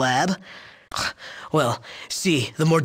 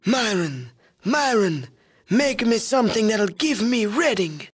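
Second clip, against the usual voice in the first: wheezing boss voice; 8 semitones higher than usual. Retold or imitated. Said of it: "in his wheezing voice and says".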